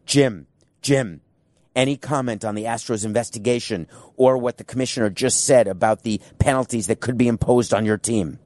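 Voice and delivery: ordinary talk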